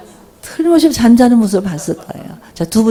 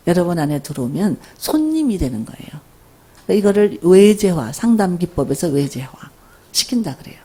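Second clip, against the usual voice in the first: ordinary delivery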